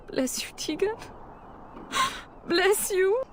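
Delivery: mother thanking Teagan, relieved; grateful relief. Acted. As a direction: overcome with gratitude. Relief when Teagan agrees.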